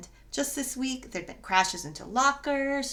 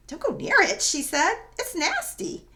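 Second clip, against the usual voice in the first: alarmed warning; 10 semitones higher, 7 dB louder than usual. Acted. Janet grabs Malia's elbow in alarm.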